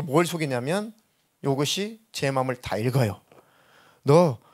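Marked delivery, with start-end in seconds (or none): 4.0-4.4 louder, higher voice of a tempting spirit; deceiving spirit's voice. Retold or imitated. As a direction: urge him on like a deceiving spirit. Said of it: "이렇게 속이더라고요". (속이는 악한 영의 목소리)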